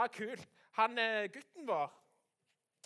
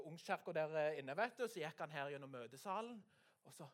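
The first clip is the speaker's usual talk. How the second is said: ordinary delivery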